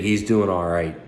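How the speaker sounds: normal talking voice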